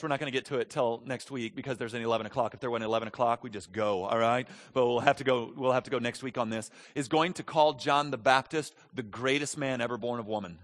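ordinary delivery

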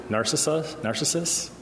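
Narcissus lifted high in a questioning rise; questioning inflection. Shown as a demonstration. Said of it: "the inflection is important".

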